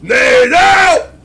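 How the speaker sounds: high, loud tactical callout; grenade warning yell. Real, not acted. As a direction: shout nade out at full power. Breaching drill, grenade callout.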